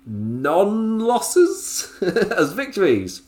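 high, slow, disbelieving; sarcastic drawl. Acted. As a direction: stretch it out, mocking the booking.

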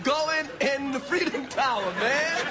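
usual speaking voice

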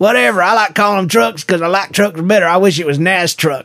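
put-on over-the-top Southern good ol' boy accent. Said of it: "That wasn't even a good accent".

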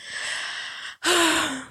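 long breath out after laughing; airy, laughed-out sigh; spent sigh. Real, not acted. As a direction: let out a sigh as the laughter winds down. Sighs after laughing through story.

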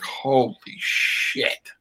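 hushed, drawn-out exclamation; awed mock reaction. Actor recalling moments on set, hushed awe. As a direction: stretch it slowly into a near-whisper.

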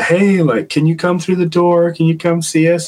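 high, sweet coaxing voice; coaxing the spirit. Retold or imitated. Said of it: "it turned into the".

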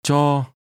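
"Cho" held on a level mid tone. Shown as a demonstration. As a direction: say "Cho" evenly, with neither rise nor fall. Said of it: "Notice the mid-level tone".

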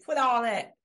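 a sharp imitated order to quit; imitating God. Speaker retelling what she felt God was saying, imitated loud command. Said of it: "I felt like God was saying".

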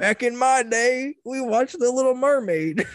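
high, drawn-out grumpy geezer; back in my day rant. Pretend. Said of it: "we'll just sound like old grumpy".

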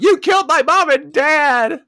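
high, loud, slow mock scream; imitated yell. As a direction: scream it like a hammed-up courtroom accusation. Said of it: "She yells out".

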